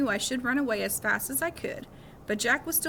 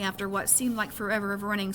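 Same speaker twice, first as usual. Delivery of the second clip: usual speaking voice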